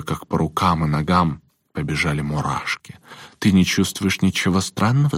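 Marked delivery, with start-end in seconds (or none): none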